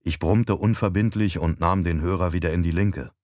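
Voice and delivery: usual speaking voice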